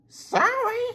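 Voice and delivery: very high, light little-engine voice; high Rosie voice. Acted. Rosie greeting Donald, mistaking him for Douglas, very high and light. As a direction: squeak it out like a small cheerful engine.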